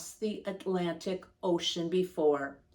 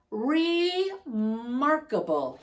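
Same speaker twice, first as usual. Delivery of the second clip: slow, amazed exclamation, pitched up; cried out; 6 semitones higher, slower than usual. A narrator's reading. Grandfather reading to kids, cries out in amazement. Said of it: "he cried".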